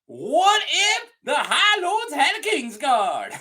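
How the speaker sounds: put-on high, slow, drawn-out Southern accent. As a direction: stretch it out in a hammed-up Southern accent. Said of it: "I don't know why I went Southern".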